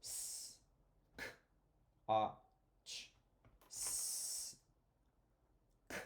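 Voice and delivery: "scotch" broken into single sounds "s", "k", "a", "ch", very soft; soft phonics sound-by-sound segmenting; hushed sounded-out letters. Shown as a demonstration. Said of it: "the second part, scotch".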